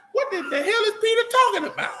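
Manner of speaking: high-pitched exasperated question, half laughing; baffled outburst. Acted. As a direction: act out a totally confused reader, voice way up. Voices puzzled readers' reaction, mocking.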